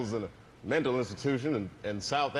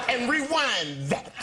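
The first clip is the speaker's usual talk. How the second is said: laughing quote; 9 semitones higher, much slower than usual. Retold or imitated. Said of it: "he goes".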